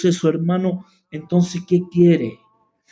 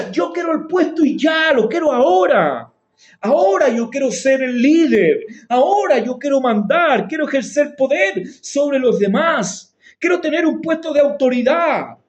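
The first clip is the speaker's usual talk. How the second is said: higher, pushy voice of someone demanding power; mock ambitious believer; 7 semitones higher than usual. Preacher mocking a believer who wants authority, in a higher, demanding voice. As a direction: play the greedy believer who wants the post now.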